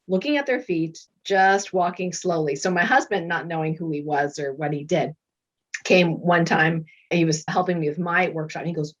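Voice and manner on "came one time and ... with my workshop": ordinary talk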